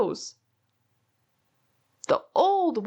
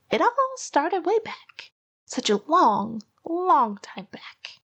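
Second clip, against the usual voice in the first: put-on old Onceler's voice; 6 semitones higher than usual. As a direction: ease into the old tale like a creaky hermit. Onceler begins his tale.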